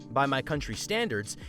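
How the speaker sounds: ordinary delivery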